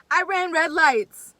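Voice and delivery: loud, slow, high proclamation; defiant shout. Real, not acted. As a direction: own it proudly, slowly. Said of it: "defiant confession".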